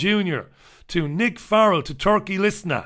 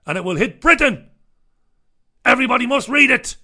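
loud, raised, hammed-up urgency; mock-urgent proclamation; 7 semitones higher, 6 dB louder, faster than usual. Host mocks the Mirror's warning to readers, mock urgency. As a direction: sell it like a breathless doom headline. Mock-dramatic reading of newspaper story.

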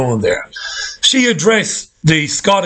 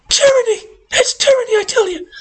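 squealing far higher, hammed-up horror; mock shocked outrage; faster than usual. Shown as a demonstration. Ranting host parodies tyranny complaints, squealing mock shock. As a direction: shriek it like a scandalised busybody. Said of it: "The voice must go up several octaves to convey your shock".

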